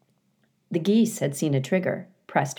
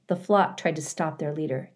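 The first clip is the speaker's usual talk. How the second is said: ordinary delivery, nothing marked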